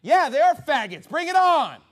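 loud, high, bold challenge; fired-up preacher's taunt; defiant shout. Acted. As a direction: belt it out like a dare. Acting out defiant response to heat.

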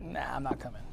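low, very soft dismissive mumble; quietly bow out under your breath; imitated reluctant churchgoer, low and quiet; offhand refusal. Congregant declining to attend service.

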